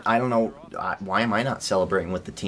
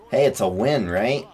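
usual speaking voice

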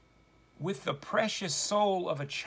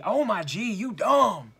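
mock parent yelling; 3 semitones higher, 6 dB louder than usual. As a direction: imitate a parent yelling at a child. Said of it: "parents who yell at her with loud voices".

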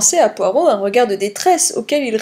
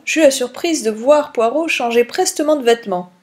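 ordinary delivery, nothing marked

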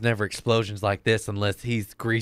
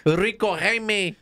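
put-on slow, high Spanish-accented name call; 10 semitones higher, slower than usual. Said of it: "[name]".

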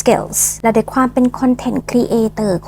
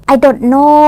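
"I don't know" louder, in a different accent; another English accent; 3 semitones higher, 5 dB louder, much slower than usual. Shown as a demonstration. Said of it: "พูดภาษาอังกฤษด้วยสำเนียงไหนก็ได้". (พูด I don't know เสียงดัง ติดสำเนียง)

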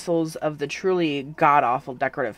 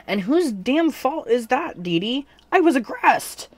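mock indignant; 10 semitones higher, 5 dB louder, slower than usual. Indignant protest to Donnie.

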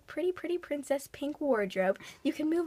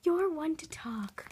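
whisper; 2 semitones higher, 4 dB quieter, slower than usual. Acted. Continuing dazed whisper.

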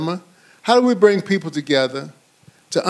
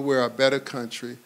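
ordinary talk

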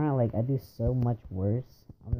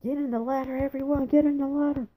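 high-pitched rallying shout; urgent shouted order; 7 dB louder than usual. Pretend. Player ordering everyone into the ladder, high urgent shout. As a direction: yell it out like a frantic order.

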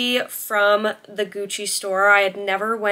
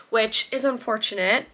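usual speaking voice

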